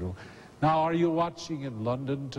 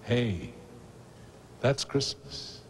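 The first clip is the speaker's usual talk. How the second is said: ordinary delivery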